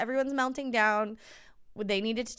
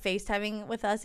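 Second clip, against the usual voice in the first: ordinary talk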